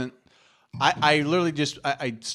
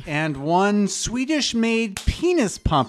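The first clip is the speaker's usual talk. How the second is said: slow, higher, laughing read; mock movie officer; 7 semitones higher, much slower than usual. S1 retells the Austin Powers scene, slow mock reading. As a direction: read the inventory slowly. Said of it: "that scene in Austin Powers".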